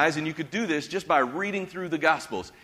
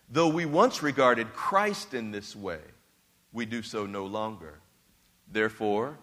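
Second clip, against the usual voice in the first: scripture reading; 5 semitones lower than usual. Acted. Reads scripture to congregation.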